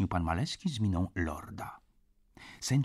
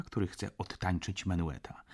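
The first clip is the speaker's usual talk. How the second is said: ordinary delivery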